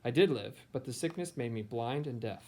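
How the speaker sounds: ordinary delivery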